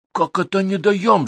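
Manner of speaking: high, slow indignant outburst; boiling up. A narrator's reading. Manager rejects Viktor's accusation, boiling up. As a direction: bridle at the accusation, pitch leaping. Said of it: "закипел управляющий".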